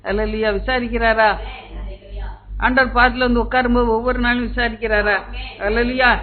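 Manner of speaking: usual speaking voice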